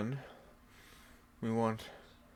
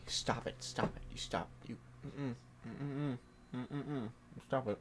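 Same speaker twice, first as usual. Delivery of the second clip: hushed stop; 8 semitones lower, 10 dB quieter than usual. Real, not acted. Hushing distractions.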